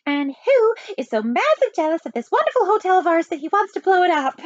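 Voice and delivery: high, excitable spy; falsetto. A narrator's reading. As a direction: build the question in falsetto. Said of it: "and a falsetto voice".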